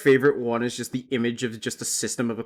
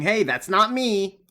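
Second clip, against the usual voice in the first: mocking smug viewer; 7 semitones higher, 4 dB louder than usual. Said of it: "out of going".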